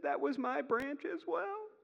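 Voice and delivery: high, slow, overcome with joy; misty-eyed. Acted. Traveler moved by the shared branch, mock misty-eyed. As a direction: squeeze it out as if tearing up. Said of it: "My eyes misted over".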